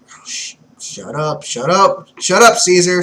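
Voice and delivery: building from muttered to loud; dismissive shut up. Acted. Doflamingo silencing Caesar.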